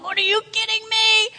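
can't believe it; stunned disbelief. Acted. Zacchaeus hearing Jesus will visit, high incredulous. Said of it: "And he's going".

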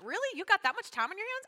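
high-pitched mock disbelief; incredulous retort. Pastor retorting to people slandering her, incredulous.

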